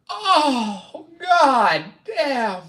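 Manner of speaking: high, loud wail of disbelief; drawn-out, high-pitched exclamation; overwhelmed outcry. Real, not acted. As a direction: cry out as if floored by the question. Overwhelmed by question about favorite meals.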